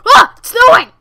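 very loud, high yelp; sudden high-pitched exclamation; startled shout. Real, not acted. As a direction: shriek it out in surprise. Startled by snow outside.

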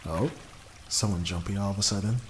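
normal talking voice

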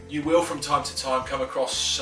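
usual speaking voice

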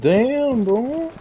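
sudden high-pitched disbelief; surprised. Real, not acted. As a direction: yelp it out, caught off guard. Teammate killed in match.

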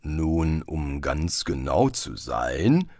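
low, slow tree voice; sprechender Baum. A narrator's reading. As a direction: begin a correction, deep and drawn out. Talking tree begins a correction. (tiefe, langsame Baumstimme)

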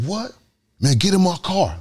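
high-pitched imitated urgency; astonished driver. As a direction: mimic a stunned driver hurrying someone in. Driver reacts to kidnapping claim.

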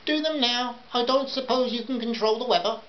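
high, put-on customer voice; high-pitched insistent housewife; bossy housewife. A narrator's reading. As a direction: order him about in a high voice.

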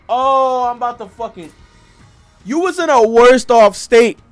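ordinary talk, nothing marked

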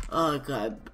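ordinary talk

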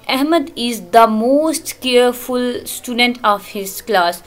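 very slow, each word laid out for students; slow classroom dictation of an English example. Shown as a demonstration. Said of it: "teacher reads example slowly".

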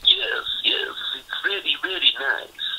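Borat. Retold or imitated. Retired boxer imitating his sparring partner's Borat act, comic accented impression. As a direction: mimic a comic foreign catchphrase voice. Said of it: "like, speak like, you know, Borat".

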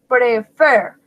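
"Prefer" with a firm stress on "fer"; emphasis on last syllable. Shown as a demonstration. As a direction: punch the final "fer" of "Prefer". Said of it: "pues lleva el énfasis". ("Prefer" con énfasis en la última sílaba, "fer")

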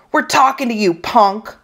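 high-pitched taunting call; bullying call. Acted. Bully calling after a kid who won't answer, taunting.

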